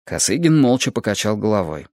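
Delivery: plain reading voice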